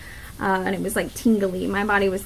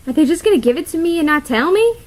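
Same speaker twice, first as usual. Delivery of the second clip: alarmed question; 6 semitones higher, 4 dB louder, faster than usual. Retold or imitated. Retelling her alarmed thought.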